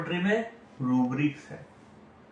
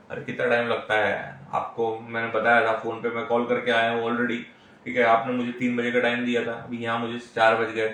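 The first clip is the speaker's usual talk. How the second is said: impatient complaint; 6 semitones lower than usual. Retold or imitated. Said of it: "वहाँ receptionist को सुना देगा".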